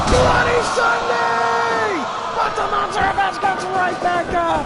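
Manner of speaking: high, shouting ringside call; frantic commentator yelling over the action; hyped play-by-play. Acted. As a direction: scream the big moves like a wrestling announcer. Calling a finishing move.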